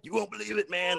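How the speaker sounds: high, wide-eyed storyteller pitch; hyped storyteller. Acted. Writer mimicking a typical opening line, hyped and high. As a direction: sell it like an unbelievable tale. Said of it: "that type of thing".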